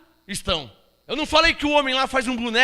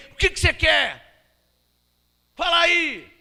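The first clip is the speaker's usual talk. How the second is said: mock rude to a king; 4 semitones higher than usual. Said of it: "Não, não é assim".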